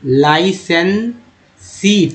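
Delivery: लाइसेंसी very slowly, as लाइ-सेन-सी; syllable-by-syllable pronunciation. Shown as a demonstration. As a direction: pause between the parts of लाइसेंसी. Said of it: "आई का इस्तेमाल हुआ है".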